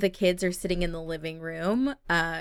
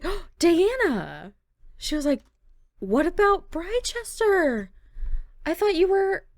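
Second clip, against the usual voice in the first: dismayed; 11 semitones higher, 4 dB louder than usual. Acted. Rachel dismayed at choice.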